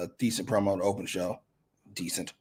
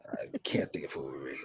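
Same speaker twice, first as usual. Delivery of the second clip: unvoiced whisper to himself; whispered aside; 8 semitones lower, 9 dB quieter than usual. Real, not acted. Host whispers he can't name anyone, under his breath.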